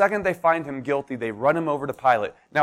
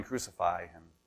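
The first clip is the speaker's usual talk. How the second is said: hushed crowd cry; 9 semitones lower, 12 dB quieter than usual. Retold or imitated. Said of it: "Now they're yelling".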